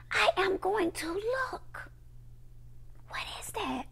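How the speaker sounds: slow, high near-whisper; hushed searching. Acted. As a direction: draw it out softly, as if peering around. Acting out a searching look.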